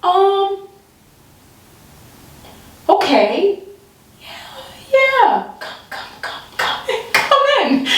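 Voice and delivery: high, breaking reluctant yes; very high reluctant invitation; reluctant welcome. Retold or imitated. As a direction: let him in against your will, high. Forced welcome of the cop.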